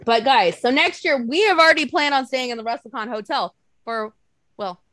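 usual speaking voice

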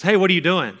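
lightly voiced nosy mom, loud and high; mom barging in. Mom walks into son's bathroom, barging in loudly.

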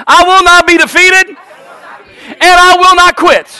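high, loud congregational chant; bold, defiant pulpit cry; rallying shout. Real, not acted. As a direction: shout it for the crowd to echo.

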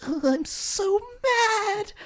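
very high, slow, pouty "so mad"; hammed-up childish pout; mock sulky whine. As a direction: drag it out like a whiny child. Just getting angry.

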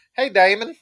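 Damon said DAY-mon, like Diamond; mispronounced name. Retold or imitated. Friend mangling the name, Damon as DIE-mon. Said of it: "that's always how it sounds".

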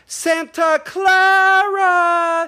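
high, loud, drawn-out exclamation; Keira Knightley. Retold or imitated. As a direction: imitate an excited movie line, stretching each word way out. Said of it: "Keira Knightley goes".

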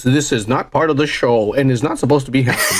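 low, formal PA announcer impression; deep, stiff apology over the loudspeaker. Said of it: "the announcer's being like".

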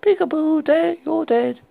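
put-on high, loud eerie child chant; sing-song it like a ghost; creepy little girl. A narrator's reading. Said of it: "The little girl was saying".

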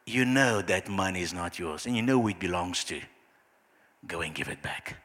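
low, hushed voice of the Holy Spirit; gentle inner whisper. Acted. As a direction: murmur it low, like a quiet nudge of conscience. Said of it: "those whispers of God".

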